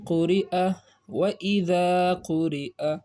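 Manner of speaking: slowed syllable joining; teacher models the joined phrase; slow recitation. Shown as a demonstration. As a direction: join the syllables slowly, reading aa at the end. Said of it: "اس کو آ پڑھیں گے".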